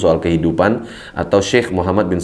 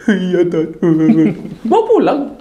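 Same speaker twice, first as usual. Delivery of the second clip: put-on whiny crying boy; 7 semitones higher, slower than usual.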